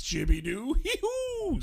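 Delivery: silly high, drawn-out goofball voice; Adam Sandler. Acted. Comedian showing how he still talks, silly high impression. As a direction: stretch it sillily, squeal the nonsense. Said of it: "Or Adam Sandler".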